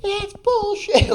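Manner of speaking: very high, drawn-out mimicked shriek; outraged meme lady. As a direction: stretch it out in a high, outraged squeal. Imitates yelling lady from meme.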